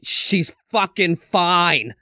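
exasperated retort. Retold or imitated. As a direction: stretch every word in mock exasperation. Exasperated retort to whispering friend.

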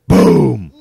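loud, falling boom; imitated wall pounding. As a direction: boom it out like a fist hitting a wall.

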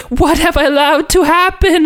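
hammed-up, very high-pitched anguished lament; Moira's dismay. Moira watches the town's destruction, mock-tragic wail.

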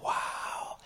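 soft, breath-only mock amazement; hushed, marvelling aside; awed whisper. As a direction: whisper it as if awestruck. Marvels at fifteen-minute prayer.

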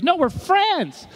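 lightly voiced Peter brushing it off; mock Peter. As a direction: shrug it off as just friends. Peter insists on friendship.

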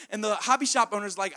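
ordinary talk, nothing marked

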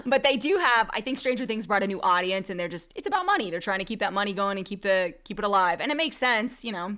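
normal talking voice

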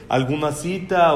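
normal talking voice

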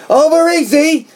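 mock yell. Imitating the cook yelling out eggs, high mock yell. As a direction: sing out the order like a yell. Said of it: "she's yelling shit out".

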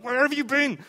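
high, loud scolding; a frantic parent telling off a child; exasperated parent. Retold or imitated. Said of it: "hug them and throttle them".

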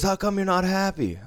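high mocking drunk taunt; drunk gambler voice. Drunk player taunting the unsmiling dealer, high mock voice. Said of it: "he goes".

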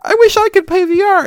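very high, loud mock child voice; whiny wishing kid. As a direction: squeal it like a pleading kid.

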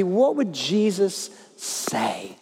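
drawn out, each word weighed; slow emphatic question. Acted. Preacher to congregation, slow weighty question. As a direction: let the question hang slowly.

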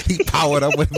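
ordinary talk

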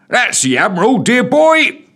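high, loud jolly policeman; beaming constable. A narrator's reading. Constable proudly introducing the dog, beaming. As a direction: beam it proudly. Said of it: "beamed the policeman".

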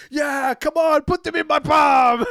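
high, loud mock rallying shout. Taguchi rallying his team, loud mock shout. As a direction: yell it to your teammates. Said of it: "He's going like".

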